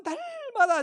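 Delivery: "날마다"의 첫 음절을 길게 늘여 힘주어; "날마다"를 길게 끌며 강하게 강조. Real, not acted. ("날마다"에 힘을 실어 길게)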